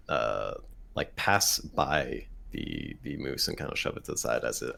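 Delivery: usual speaking voice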